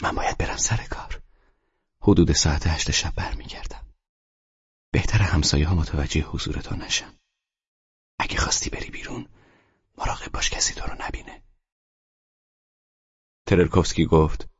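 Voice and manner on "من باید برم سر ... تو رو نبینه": hushed, breathy whisper; soft morning whisper before leaving for work; quiet whisper. A narrator's reading. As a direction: whisper so the neighbours won't hear. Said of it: "با صدای آرامی گفت".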